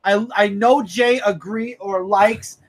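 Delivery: ordinary talk, nothing marked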